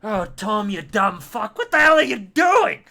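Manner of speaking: loud, high scolding, building to a shout; yelling. Acted. Middle-aged man to child in the road, yelling. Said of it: "when he yells".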